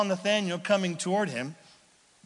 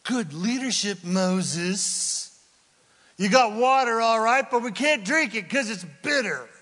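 dry sarcastic jab, then louder; sarcastic crowd; 5 semitones higher, 4 dB louder than usual. Acted. People mocking Moses over bitter water, sarcastic. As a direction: needle Moses with mock praise. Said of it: "narrow their gaze at Moses".

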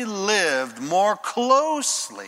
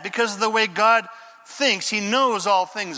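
ordinary talk

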